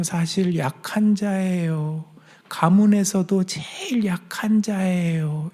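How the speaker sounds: slow, whispered timid confession; timid Gideon whispering that he is the weakest; small quiet voice. Acted. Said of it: "조용히 목소리가 작아져요".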